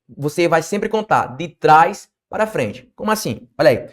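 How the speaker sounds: ordinary talk, nothing marked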